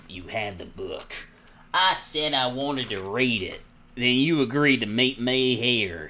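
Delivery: slow Southern drawl; Southern-accented cop. Acted. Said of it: "he's gonna get a southern accent".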